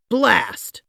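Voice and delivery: a sharp, irritated curse; annoyed "Blast". A narrator's reading. President stuck in his bath, cursing his luck. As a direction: grumble it like a frustrated president.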